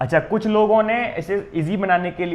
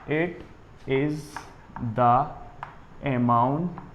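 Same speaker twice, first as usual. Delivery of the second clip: very slow, one word at a time; slow dictation; much slower than usual. Real, not acted. Teacher dictates the second definition to students, slow dictation. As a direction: dictate with long gaps for writing.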